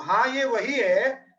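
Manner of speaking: drawn-out, deliberate "हाँ, ये वही है"; slow remembering voice; slow emphatic recognition. Retold or imitated. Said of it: "तो".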